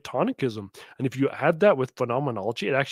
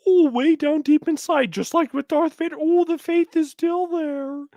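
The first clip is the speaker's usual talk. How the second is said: mock sentimental echo; 11 semitones higher, 4 dB louder than usual. Host mocks guest's line with sugary swooning voice. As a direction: croon it like a sappy movie.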